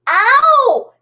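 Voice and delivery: sharp ow of pain; pained yelp. Real, not acted. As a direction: yelp as you burn your finger. Burned finger on incense holder.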